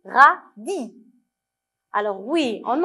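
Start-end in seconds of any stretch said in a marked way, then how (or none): 0.0-1.0 "radis" split as RA, pause, DI; loud, separated syllables for listening out sounds. Shown as a demonstration. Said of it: "Est-ce qu'on entend le son I".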